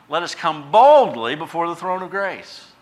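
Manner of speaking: bold reading. Acted. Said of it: "we're told in Hebrews".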